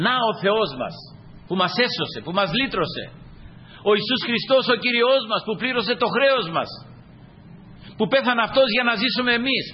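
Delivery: high-pitched, fervent exhortation; impassioned preaching. Real, not acted. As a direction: urge the congregation with lifted voice.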